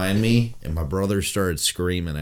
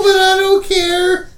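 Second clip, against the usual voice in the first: laughing; 10 dB louder than usual. Real, not acted. Laughing through the bit.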